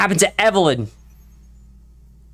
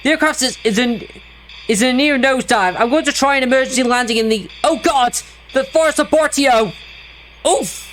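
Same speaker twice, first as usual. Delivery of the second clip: panicked distress call; 5 semitones higher than usual. Acted. Pilot describing nosedive in distress call.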